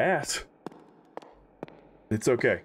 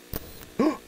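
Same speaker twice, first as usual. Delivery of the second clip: sharp gasp. Real, not acted. Startled by something in game.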